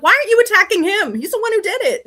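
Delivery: loud, high-pitched retold outrage; indignant protest. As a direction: argue back in high, loud exasperation. Retold argument with tree spirit.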